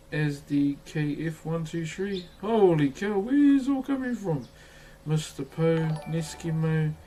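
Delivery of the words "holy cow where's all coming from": high amazed exclaim; surprised. Real, not acted. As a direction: blurt it out in amazement. Flood of subs.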